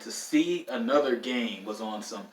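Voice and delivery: ordinary talk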